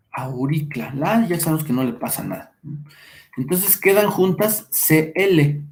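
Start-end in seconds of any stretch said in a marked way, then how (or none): none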